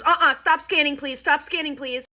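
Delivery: imitating a mom cutting in; firm interruption. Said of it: "Literally just like that".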